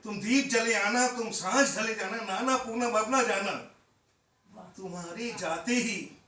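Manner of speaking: loud, ringing verse that falls away at the close; impassioned poetry recitation. Acted. Poet calling Poonam to return, impassioned recitation. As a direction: declaim it as a plea to come back.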